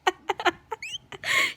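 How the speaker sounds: high-pitched burst of laughter. Real, not acted. Girl amused by Abigail's shoe attack, laughing. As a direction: laugh helplessly mid-story. Laughing while telling the story.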